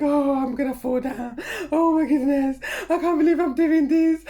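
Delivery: high, loud panicky cry; frightened exclaiming. Retold or imitated. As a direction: squeal in disbelief at the height.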